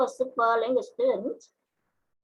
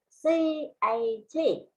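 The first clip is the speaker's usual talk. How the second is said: slow, higher, one letter at a time; spelling out; 4 semitones higher, slower than usual. Shown as a demonstration. Teacher spells a word for the class, spelling out. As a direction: spell out C, A, T slowly and separately. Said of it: "you are spelling the word cat".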